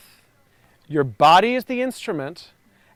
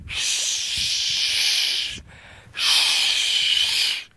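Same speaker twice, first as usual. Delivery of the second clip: long unvoiced shh like wind; shh sound. Shown as a demonstration. Coach demonstrating for the class, long breathy shh. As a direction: breathe out a steady shh through the trees. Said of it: "and it looks like this".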